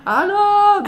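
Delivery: loud, high-pitched retold phone shout. Calling lost friends on the phone in a crowd, loud shout. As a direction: call out over deafening noise. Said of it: "prata i telefon och jag hörde ingenting".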